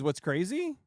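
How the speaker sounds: usual speaking voice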